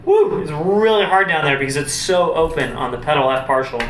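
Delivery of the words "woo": excited woo. Real, not acted. Cheer after hard low passage.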